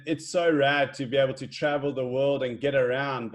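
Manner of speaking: ordinary talk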